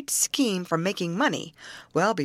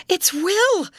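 high, loud near-shriek of recognition; half-hysterical scream; 7 dB louder than usual. Acted. Sister recognizing her lost brother, half-hysterical scream. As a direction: scream it out, almost losing control. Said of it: "she screamed, half hysterically".

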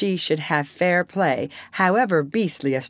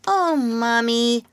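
put-on high, drawn-out child's cry; 9 semitones higher, much slower than usual. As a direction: call out to mother like a little boy. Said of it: "cried the first twin".

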